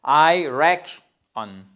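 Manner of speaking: very slow, loud RECK in "reckon", then weak ending; first-syllable stress. Shown as a demonstration. Teacher drilling the first syllable, slow loud RECK in "reckon". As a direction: punch and stretch RECK in "reckon", pause after K. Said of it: "c'est toute la première syllabe".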